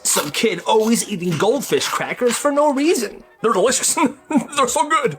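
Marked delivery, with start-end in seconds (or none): 4.0-4.5 quick bright giggle; a little laugh at herself; short laugh. Real, not acted. Laughs about crackers.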